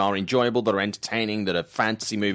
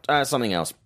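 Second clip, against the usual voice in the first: ordinary talk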